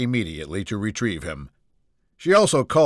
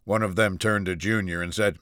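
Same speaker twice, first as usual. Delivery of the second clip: ordinary delivery, nothing marked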